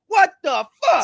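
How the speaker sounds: high, loud exasperated shout; retold furious outburst; mad as a hornet. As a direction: cap the re-enacted rant with a yell. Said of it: "madder than a motherfucking hornet".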